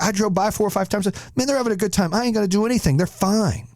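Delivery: high-pitched, easygoing cop voice; imitated cop. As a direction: play the cop who's cheering the party on.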